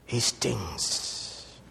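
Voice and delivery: ordinary talk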